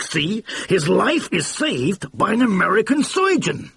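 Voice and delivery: a blustering mogul catching his mistake; excited brash producer. A narrator's reading. Producer pitching idea.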